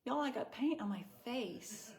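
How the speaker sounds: hammed-up Southern accent, mocking herself gently; Melissa's Southern accent. Imitating a friend's Southern accent about paint on her face. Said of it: "Maybe I could try a Melissa accent".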